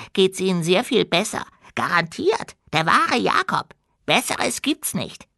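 high, squeaky goblin voice. A narrator's reading. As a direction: chatter like an eager little goblin. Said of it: "Der Goblin".

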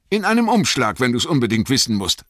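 testy little hobbit, pitched high and loud; Bilbo, gereizt. A narrator's reading. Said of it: "sagte Bilbo gereizt". (gereizt, mit hoher Stimme)

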